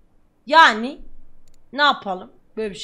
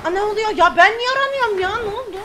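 alarmed surprise; 8 semitones higher, 8 dB louder, faster than usual. Real, not acted. Wanted by police in game.

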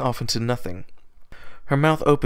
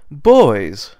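loud call across the road; 8 dB louder than usual. Acted. Rarity calling the bronies in the rain, loud call. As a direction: call them over, loud.

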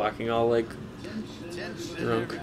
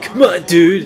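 surfer dude; 4 semitones higher, 9 dB louder, slower than usual. Retold or imitated. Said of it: "He sounds like a surfer".

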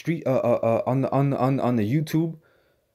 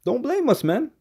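"don't blame us" with its pitch jumping far higher on "blame"; high warning; 11 semitones higher than usual. Shown as a demonstration. Preacher warns the crowd about a windshield, voice leaping high. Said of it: "warning repeated to scoffers".

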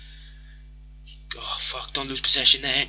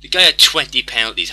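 loud rant; 8 dB louder than usual. Real, not acted. Ranting about missed penalty.

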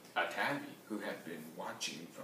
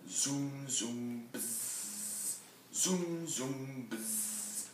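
hummed zooms fading into a long buzz; buzzing bass; much slower than usual. A narrator's reading. Double bass buzzing as Berlioz practices, droned imitation. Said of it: "a strange buzz was coming".

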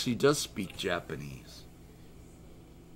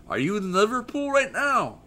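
much higher, louder surprised question; amazed, pitched-up question to the chat; 13 semitones higher, 7 dB louder than usual. Real, not acted. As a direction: shoot up high in disbelief.

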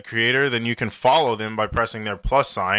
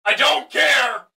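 impatient heckler shout; 13 semitones higher than usual. Acted. Heckler interrupting the creator, high and shouting. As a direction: bark at him to get to the point.

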